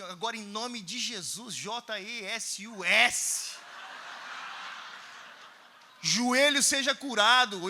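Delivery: very slow, every letter separate; slow spelled-out prayer. Retold or imitated. Preacher spelling out Jesus' name slowly while praying for the knee. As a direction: spell each letter deliberately.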